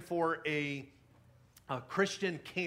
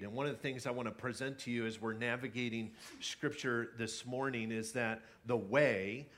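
ordinary talk

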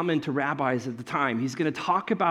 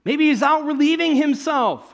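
higher, louder jeering repeat of the joke; mocking taunt; 10 semitones higher, 6 dB louder than usual. Elijah taunting the prophets of Baal, higher and louder. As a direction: savor the cheeky taunt. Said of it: "We can say it".

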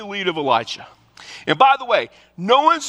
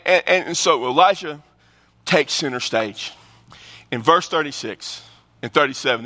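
normal talking voice

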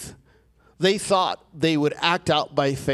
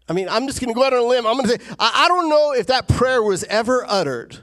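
louder, higher and fast, fired up; preaching at full force; 8 semitones higher, 5 dB louder, faster than usual. Acted. Preacher to congregation, fired-up fast preaching. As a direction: drive it home like a revival preacher.

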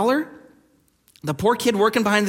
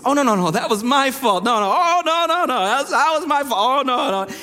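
mock polite apology; 5 semitones higher than usual. Churchgoer faking humility after a conflict, hammed-up and gracious. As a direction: ham up the fake humility. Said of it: "we say".

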